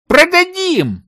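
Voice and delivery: very high, delighted proposal; eager exclamation. A narrator's reading. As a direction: blurt out a profitable idea. Searcher's eager idea. (восторженное предложение)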